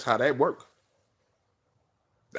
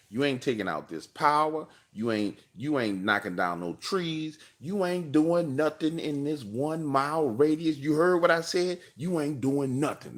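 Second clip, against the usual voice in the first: higher, slow, drawn-out scolding; 6 semitones higher, slower than usual. Retold or imitated. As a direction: stretch each warning with sassy attitude.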